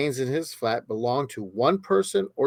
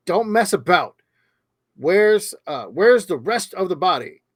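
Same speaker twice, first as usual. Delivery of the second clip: mock stern detective; 6 semitones higher, 3 dB louder than usual. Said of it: "Jay replied".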